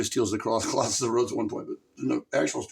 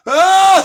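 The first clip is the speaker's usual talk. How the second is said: high, loud sudden cry; real startled shout; startled yell; 22 dB louder than usual. Real, not acted. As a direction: yelp at the sudden hit. Gaming loss surprise.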